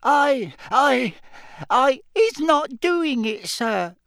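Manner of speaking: high squeaky elf, gasping; frightened house-elf squeak. Acted. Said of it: "Winky gasped".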